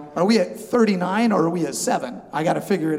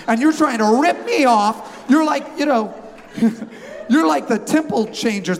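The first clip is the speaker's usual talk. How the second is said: higher, louder, hammed-up indignation with laughs; mock offended outrage; 7 semitones higher, 5 dB louder than usual. Preacher haggling in a Jerusalem market, hammed-up outrage. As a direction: put on a big show of being offended. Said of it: "I'm gonna pull the offended card".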